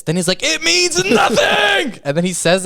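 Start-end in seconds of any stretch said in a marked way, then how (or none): 0.4-2.0 slow, high, hammed-up shout; retold scream. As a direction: overact it as a stage wail. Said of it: "keeps screaming that line".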